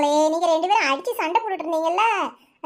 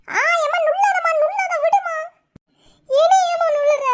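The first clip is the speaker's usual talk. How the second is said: high, loud tearful protest; pained whine; 10 semitones higher, 3 dB louder than usual. Acted. Girl being pinched pleads with mother, high tearful whine.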